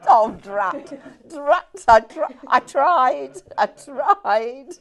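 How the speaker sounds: loud, high playful lament through laughter; laughing mock dismay. Real, not acted. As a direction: cry defeat jokingly while cracking up. Mock dismay at failed microphone attempt.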